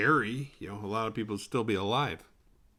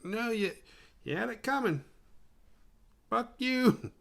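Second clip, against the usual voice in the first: higher mock retort; snappy comeback; 12 semitones higher than usual. Answering the bully with a high mocking retort. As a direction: fire back at the bully in a raised voice. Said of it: "And it's like".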